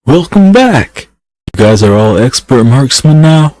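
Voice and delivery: big booming hello, slowed; loud greeting. Acted. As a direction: call out a welcome across camp. Calling out to returning group.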